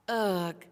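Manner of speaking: loud grimacing ugh of disgust; a girl's loud ugh as she pulls a face. A narrator's reading. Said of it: "Lucy made a face".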